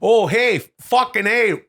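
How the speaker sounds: mock Canadian bro, loud and high; Canadian Ryan Gosling. Host mocking his co-host's Ryan Gosling, hammed-up Canadian accent. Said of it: "Chad's Ryan Gosling".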